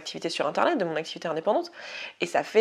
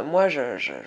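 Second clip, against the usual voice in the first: ordinary delivery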